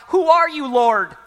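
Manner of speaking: a loud, high cry up to heaven; startled cry. Acted. Saul on the road crying out to the voice, loud and high. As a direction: Saul calls out to the blinding light, loud and high.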